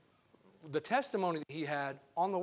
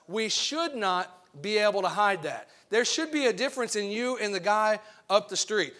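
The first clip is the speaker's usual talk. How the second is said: louder, rising preacher's call; fiery preaching; 2 semitones higher, 6 dB louder than usual. Acted. Preacher to his congregation, preaching with rising force. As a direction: preach it out with full conviction.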